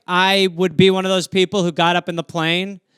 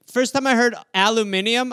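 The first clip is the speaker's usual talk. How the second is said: usual speaking voice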